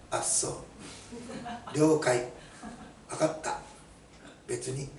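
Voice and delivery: mock curt one-word answers, softly dropped; short, detached replies with gaps between; terse retold replies. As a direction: imitate a son who barely talks. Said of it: "家族にあんまり喋らない".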